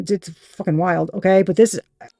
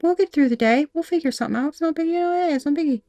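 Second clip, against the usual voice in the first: much higher, bright breezy voice that softens toward the end; all cheery; 6 semitones higher than usual. Retold or imitated. The querent shrugging off hardship, breezy and cheery. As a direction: play it carefree, nothing can touch you. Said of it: "you're just all cheery".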